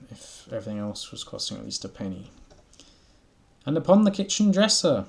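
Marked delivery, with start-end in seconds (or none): 3.6-5.1 high loud cheeky squeak; saucy mouse jeer, high and loud; rude mice. Acted. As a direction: taunt like cheeky little mice. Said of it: "added the rude little mice".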